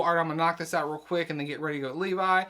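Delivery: quick confident self-talk; fast, breezy. Retold or imitated. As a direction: rattle it off fast. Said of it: "you're working on school".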